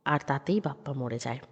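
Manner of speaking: ordinary delivery, nothing marked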